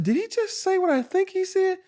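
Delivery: squeaky high, can't-believe-my-ears question; stunned disbelief. Retold or imitated. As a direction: replay the shock of being cussed out. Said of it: "I said".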